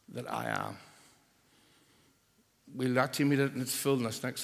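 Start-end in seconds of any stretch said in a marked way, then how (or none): 0.1-0.8 whispered. Shown as a demonstration. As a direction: whisper it softly. Whispered quote of Jesus.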